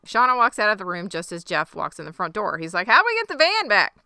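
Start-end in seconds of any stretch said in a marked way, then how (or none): 2.9-3.9 panicked. Retold or imitated. Jeff panicking in retold scene.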